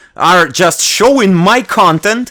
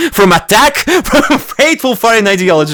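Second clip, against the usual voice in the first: laughing, half-ironic doom phrasing; laughing mock drama; 7 semitones higher, 3 dB louder than usual. Real, not acted. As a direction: poke fun through laughter.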